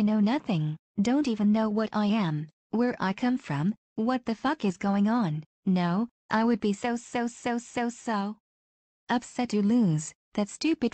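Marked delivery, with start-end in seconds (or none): none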